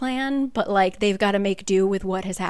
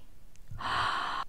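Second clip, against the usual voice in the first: sharp, breathy, hushed gasp; dramatic gasp; 10 dB quieter than usual. Real, not acted. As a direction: gasp at the cliffhanger. Dramatic end of the book.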